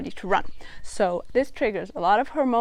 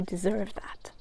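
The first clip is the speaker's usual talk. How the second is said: whisper; 10 dB quieter, slower than usual. Real, not acted. Soft aside to viewer.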